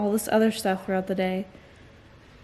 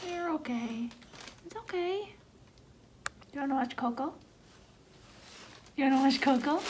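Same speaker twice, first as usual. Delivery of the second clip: high, softer reassuring coo; soft soothing; 4 semitones higher, 9 dB quieter than usual. Real, not acted. Mother soothing her daughter during tests, soft and high.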